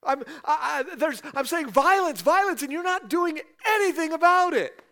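loud, high, furious cry of protest; a prophet yelling at heaven with no pleasantries; raw complaint to God. Acted. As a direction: shout the grievance straight at God. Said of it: "No pleasantries. Just jumps right into it".

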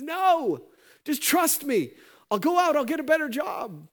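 high, loud desperate begging; pleading debtor. Acted. Debtor begs the master not to jail him, high pleading.

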